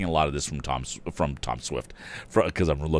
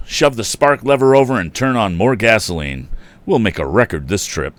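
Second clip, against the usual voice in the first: lively, louder read-aloud storytelling; story reading; 3 semitones higher, 8 dB louder than usual. A narrator's reading. Narrator reading an old boys' adventure to listeners, lively.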